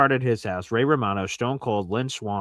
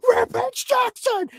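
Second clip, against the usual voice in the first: much higher, slow shouted name across an airport; mock fan shout; 4 dB louder, slower than usual. Shown as a demonstration. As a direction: call out like a starstruck fan. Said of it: "You don't just go".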